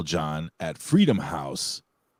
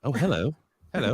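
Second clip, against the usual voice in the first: put-on higher, playful British accent; 5 semitones higher than usual. Host answering the co-host's impression, mock British accent. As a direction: greet like a put-on Brit. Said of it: "You do a British accent".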